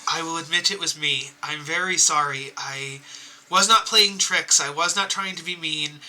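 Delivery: apologizing gently to the crying giant; sincere apology. Acted. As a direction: say sorry softly and earnestly. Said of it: "I would just go over and be like".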